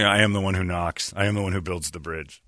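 low, quiet menacing threat; deadpan menace, dropped low. Retold or imitated.

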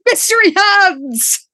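high, slow, big announcement; exclamatory. Acted. Host announcing her favorite cast member, exclamatory. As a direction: proclaim it like a returning star. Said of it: "with a load of exclamation marks".

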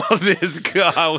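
slow, high, loud words through laughter; laughing. Real, not acted. Laughing at next profile.

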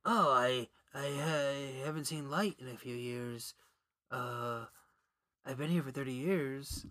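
a lightly voiced tired cave dweller; mock old hermit. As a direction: sound like you haven't seen light in years. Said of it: "he's going from ... to".

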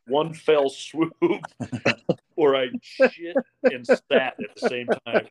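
laughing it out, high, slow; half-laughing as he tells the worst bit. Real, not acted. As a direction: giggles break through the words. Laughing at his own story.

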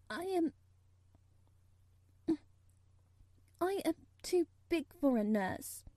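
put-on a nervous child begging; pleading girl voice. A narrator's reading. Mary pleading timidly.

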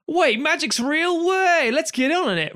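high, slow, gleeful street magician voice; drawn-out giddy amazement, pitched well up; excited street magician. Retold or imitated. Street magicians giddy that magic is real, high and slow. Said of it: "all these street magicians go".

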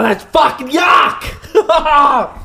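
high, long drawn-out groaning complaint; disgusted cry. Real, not acted. Just swallowed a nasty shot, disgusted cry. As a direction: wail at the awful taste. Reacting to the shot's taste.

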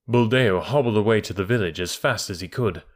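usual speaking voice

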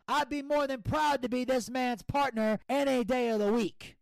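put-on fired-up hometown babyface; mock proud promo; Brad Armstrong impression. Impersonating Brad's promo.

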